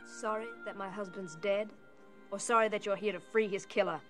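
bitter. Acted. Widow confronts the lawyer.

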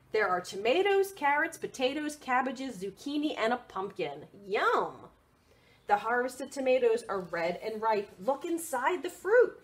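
lively picture-book read; reading to children. Acted. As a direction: read it out brightly for little ones.

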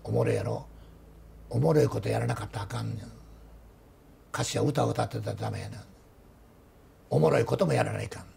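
a lightly voiced teacher, pleased with himself; teacher's voice. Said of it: "先生、面白かった".

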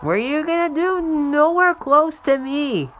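mocking high singsong; high taunt. Real, not acted. Gamer taunting a distant rival, mock singsong. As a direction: stretch it out slowly to tease.

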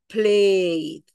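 drawn-out "played", one syllable ending in D; "played" with E absorbed. Shown as a demonstration. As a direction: stretch "played" straight into the D. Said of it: "La D va a absorber el sonido de la E".

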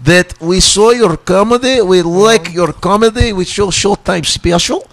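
broken English like a Russian promoter; imitating Russian festival organizers inviting him; Russian promoter accent. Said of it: "They were like".

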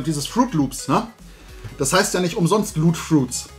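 ordinary delivery

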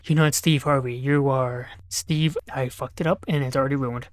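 ordinary delivery

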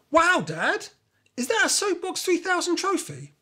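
delighted disbelief at the trophy; amazed. A narrator's reading. Kimmy discovers her dad's old trophy, amazed.

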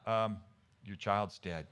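low, soft, hesitant admission; reluctant, subdued. Acted. Servants telling King David of the death, low and reluctant. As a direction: break bad news quietly, haltingly.

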